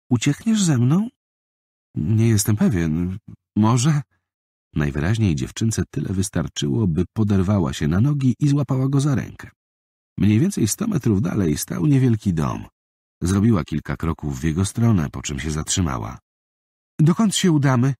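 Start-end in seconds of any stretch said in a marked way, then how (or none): 0.1-1.1 put-on much higher girlish plea; a small girl begging him along; little girl's voice. Girl asks boy to run. 1.9-4.0 put-on slower, higher hesitant boy; a small boy not sure what to say; young boy's voice, unsure. 17.0-17.9 put-on much higher, louder girlish question; an eager small girl asking; little girl's voice. Girl asks where to go.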